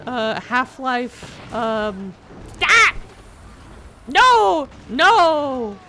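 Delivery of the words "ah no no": loud, high cry of alarm; shout. Retold or imitated. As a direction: yell no in panic. Game quote, yelling.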